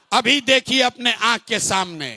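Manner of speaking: usual speaking voice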